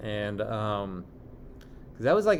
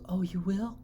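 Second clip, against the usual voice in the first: high-pitched, questioning mock surprise; lightly imitated hopeful surprise; imitating surprised mom; 9 semitones higher than usual. Voices mom's surprised reply.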